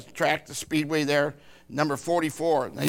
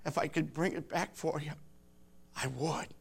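tearful plea that sinks to a whisper; crying; 11 dB quieter than usual. Retold or imitated. Grieving husband tells his wife he can't bring the past back, through tears. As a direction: voice breaking, then fading to a whisper. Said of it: "in tears, I cried with".

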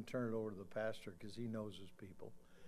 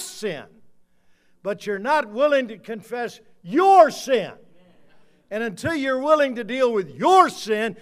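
thundering, high-pitched rebuke retold from the pulpit; louder retort; 11 semitones higher, 23 dB louder than usual. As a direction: hurl it back at full volume, stretching key words. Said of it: "said back to him louder than he said about his wife".

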